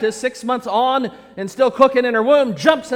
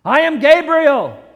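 loud, raised, slow declaration; proclaiming archangel; 5 semitones higher, 5 dB louder than usual. Acted. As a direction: announce it with slow, commanding authority. Angel proclaims identity with authority.

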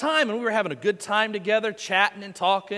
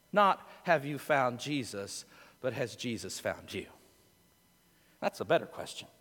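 much softer, lower reflective murmur; hushed musing; 10 semitones lower, 12 dB quieter than usual. Acted. Pastor musing softly to his congregation about salvation. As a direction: lean in and say it softly. Soft reflective sermon close.

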